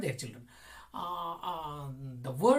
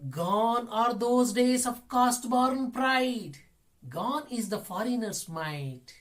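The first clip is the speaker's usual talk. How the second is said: loud, high, slow declaiming; poem recitation; 5 semitones higher, 5 dB louder, slower than usual. Acted. Teacher reading patriotic poem to children, loud slow recitation. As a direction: proclaim the verse on a raised, sustained note.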